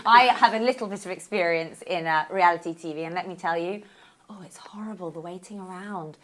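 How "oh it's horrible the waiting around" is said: whispered aside. Real, not acted. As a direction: drop to a breathy whisper. Aside about reality TV waiting.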